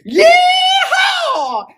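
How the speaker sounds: very high, loud yeehaw; cowboy yell. Acted. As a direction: let out a rodeo holler. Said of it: "you got the best cowboy voice".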